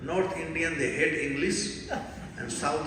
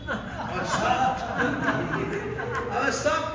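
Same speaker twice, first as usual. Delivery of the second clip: mock shock; 7 semitones higher, slower than usual. Retelling his shock at the kid.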